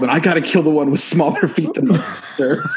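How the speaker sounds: laughing. Real, not acted. Laughing through a movie quote.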